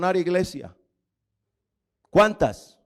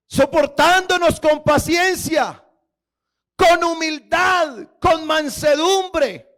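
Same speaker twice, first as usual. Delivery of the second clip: louder, higher, slow, stretched words; emphatic preaching; 9 semitones higher, 5 dB louder, slower than usual. Real, not acted. Pastor exalting Christ's patience to the congregation. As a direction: proclaim each virtue.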